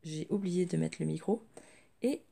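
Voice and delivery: speaking through a sore throat; strained sore-throat voice; swollen tonsils voice. Real, not acted. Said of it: "Donc je parle un peu bizarre".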